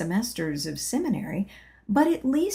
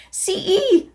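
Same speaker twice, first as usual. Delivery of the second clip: gasped; 12 semitones higher, 5 dB louder than usual. Acted. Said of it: "I gasped".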